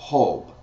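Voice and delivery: Job said as Hob, with an h for the J; teacher showing the J sound; Spanish J as h. Shown as a demonstration. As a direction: breathe the J like an h. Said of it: "it's pronounced as Ho".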